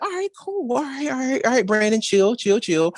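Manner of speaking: high-pitched self-talk, settling down by degrees; self-calming. Retold or imitated. Host replaying how they reined in excitement, self-calming. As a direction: start high, then talk yourself back down.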